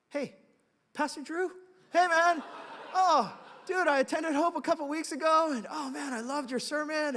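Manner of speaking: high, loud gushing fan voice; an eager young guy gushing over the pastor; excited young fan. Retold or imitated. Young fan runs up to the pastor, gushing loudly. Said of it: "he goes".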